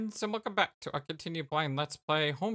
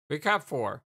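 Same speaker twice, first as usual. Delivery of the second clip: usual speaking voice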